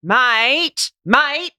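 loud, high Australian double mate, rising; long drawn mate then a short one, in Aussie accent. Shown as a demonstration. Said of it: "I'll have to do the accent".

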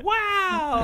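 sudden high-pitched "wow"; mock amazement. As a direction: squeal it like a huge reveal. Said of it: "he's like".

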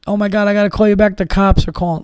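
mock crying aunt. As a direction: imitate a frantic aunt hurrying off the phone. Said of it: "calls you up crying".

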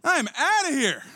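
high-pitched mock indignation; acted outrage, jumping up in pitch; offended listener. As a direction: recoil as an affronted churchgoer, then storm off. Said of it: "you're like".

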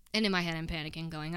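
ordinary delivery

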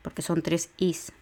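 usual speaking voice

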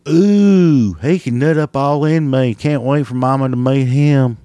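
mock swooning woman. Mocking smitten redneck woman.

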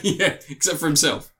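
ordinary talk

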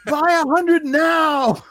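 a far-off voice shrieking with joy; screaming from the back. Retold or imitated. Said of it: "I hear somebody scream from the back".

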